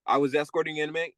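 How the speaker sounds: normal talking voice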